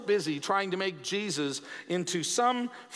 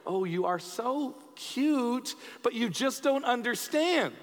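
put-on slow, higher-pitched cooing at a child; patronising parent; 4 semitones higher, slower than usual. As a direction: draw it out like an indulgent parent. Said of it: "how many of you as parents go".

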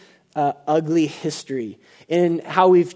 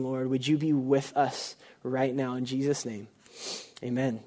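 prayer; 3 semitones lower than usual. Acted. Pastor leading the church in prayer, hushed and reverent. Pastor prays over the congregation.

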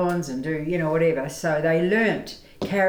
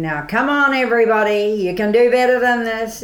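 loud, high mock New Zealand accent; hammed-up New Zealand accent pep talk; Larry Tomzak New Zealand accent; 5 semitones higher, 6 dB louder than usual. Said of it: "he started talking with the New Zealand accent".

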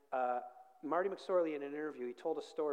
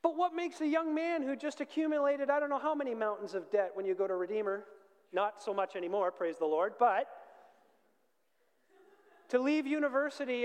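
loud, raised voice easing as it goes; fervent preaching; 6 semitones higher, 8 dB louder than usual. Real, not acted. Preacher praising a young man's sacrifice for the King, fervent. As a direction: preach it out forcefully, starting high.

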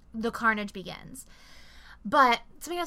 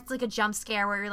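ordinary talk, nothing marked

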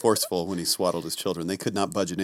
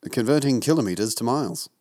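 put-on English accent; affected English accent, slightly lifted; 3 semitones higher than usual. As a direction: answer in a mock English accent. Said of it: "stop doing the accent".

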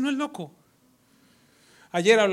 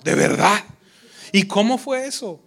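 louder, hammed-up amazement; mock surprise; 5 semitones higher, 10 dB louder than usual. Manipulator faking surprise to the prophet, loud. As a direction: fake being amazed. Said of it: "el Señor me mostró".

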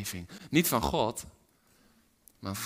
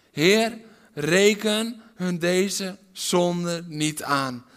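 loud, very slow, higher cry; cried out; 8 semitones higher, 11 dB louder, much slower than usual. Retold or imitated. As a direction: call to heaven with last strength. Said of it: "riep hij uit". (luid en traag uitroepen)

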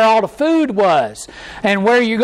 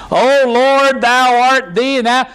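lightly hammed-up old-fashioned prayer voice, higher; mock King James prayer; 5 semitones higher, 3 dB louder than usual. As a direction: parody a pompous churchy prayer. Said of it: "speak like that in King James English".

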